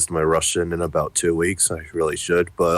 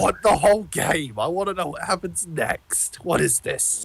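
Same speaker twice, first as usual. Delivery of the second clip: high-pitched insistent whine; mock demanding outburst; 11 semitones higher than usual. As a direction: plead high, drawing it out. Overdone whining demand for the game.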